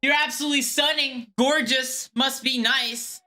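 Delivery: high, gushing mock compliment, slowing at the end; syrupy imitation of a fake admirer; mock flattery. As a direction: ham up a flatterer's sweet talk. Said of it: "It just seems like a weird compliment".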